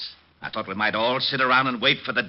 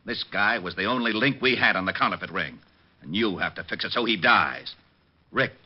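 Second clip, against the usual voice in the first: ordinary talk, nothing marked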